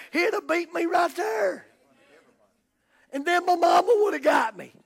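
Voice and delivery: loud, high, building to a shout; full-force preaching. Real, not acted. Preacher telling church how his parents would have punished him, fired-up shouting. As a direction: hammer it out at the top of the voice.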